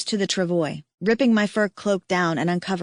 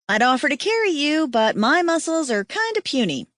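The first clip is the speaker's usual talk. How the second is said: joking; 7 semitones higher than usual. Acted. Liz joking about muscles.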